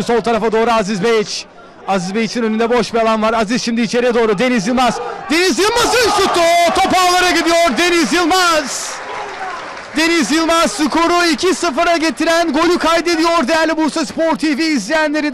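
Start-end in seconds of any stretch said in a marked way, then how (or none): 5.3-15.3 screaming football commentator. Real, not acted. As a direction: belt out the goal call. Second goal call.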